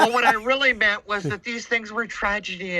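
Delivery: mock backpedal. Scared Mason backpedaling on camera.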